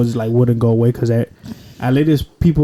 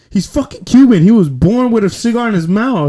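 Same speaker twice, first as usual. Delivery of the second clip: raised, high-pitched exclamation; uncle's loud retort; 8 semitones higher, 5 dB louder than usual. Retold or imitated. Imitated uncle jumping in for the Cuban nephew, loud high retort. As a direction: blurt it loud and high, playfully indignant. Acts out uncle loudly defending him.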